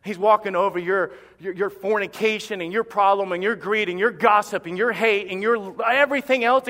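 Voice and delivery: ordinary talk, nothing marked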